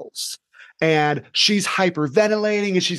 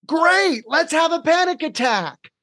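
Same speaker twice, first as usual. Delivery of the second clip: much higher, bright mock-cheerful invitation; mock enthusiastic; 12 semitones higher, slower than usual. Teacher greeting a panicking girl with mock enthusiasm. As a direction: sell it like a fun game show. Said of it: "they both looked at me like I was nuts".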